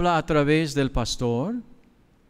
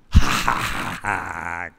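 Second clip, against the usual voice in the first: mocking laugh; 10 semitones lower, slower than usual. Neighbor's mocking laugh acted out.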